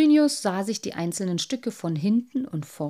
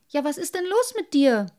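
put-on higher, eager boyish voice; a proud little boy defending his finds; little water sprite; 9 semitones higher, 6 dB louder than usual. Little Wassermann asks.

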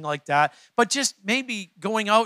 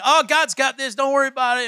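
glib, higher and louder mock reassurance; бодрое отмахивание; 6 semitones higher, 5 dB louder than usual. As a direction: breezily brush the worry off, imitating a cheerleader. Mimics glib encouragers giving high fives.

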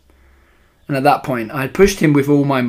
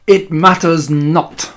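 loud, slow, imperious pronouncement; Grand High Witch shouting; 3 semitones higher, 6 dB louder, much slower than usual. A narrator's reading. Grand High Witch dismissing the lost mouse, shouting. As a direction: shout it grandly, dragging out each word. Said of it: "shouted the Grand High Witch".

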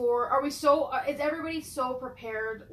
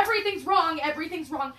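higher and louder, like blaring sirens of panic; panicking alarm voice; 5 semitones higher, 5 dB louder, faster than usual. Retold or imitated. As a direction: cry out as the anxious part of the brain.

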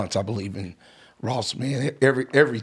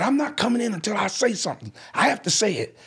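high, insistent teenager, retold; determined upset son; 10 semitones higher than usual. Son at the door demanding his say, upset and insistent. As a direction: stand your ground in a high, urgent voice. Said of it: "he said".

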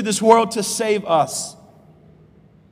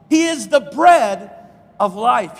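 emphatic preaching; 3 semitones higher, 6 dB louder, slower than usual. Acted. Preached climax.

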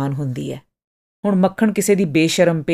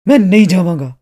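loud, furious refusal, hit hard from the first word; angry; 7 dB louder than usual. A narrator's reading. Artist refusing to be dragged out, shouting angrily. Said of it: "ਉਹ ਗੁੱਸੇ ਨਾਲ ਬੋਲਿਆ".

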